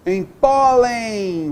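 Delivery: slow emphatic teacher example; drawn-out demo. Shown as a demonstration. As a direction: drag out pólen so the en glide is heard. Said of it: "vogal, semivogal".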